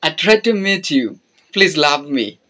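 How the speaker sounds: usual speaking voice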